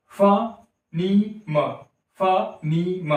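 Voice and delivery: "फनीम" slowly as fa, nee, ma; broken into parts. Shown as a demonstration. Teacher showing it takes several breaths, "फनीम" split fa-nee-ma. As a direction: split "फनीम" into three separate beats. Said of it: "फनीम नहीं बोल रहा है एक ही बार में".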